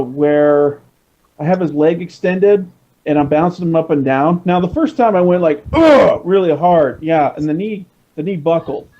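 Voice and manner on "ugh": effort grunt. Retold or imitated. Yanking the toy down hard.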